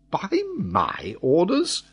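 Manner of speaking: high, slow incredulous question; astonished cry. A narrator's reading. Glenarvan amazed at the sailor's reply, incredulous. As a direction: echo it in disbelief, drawn out. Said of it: "cried Glenarvan".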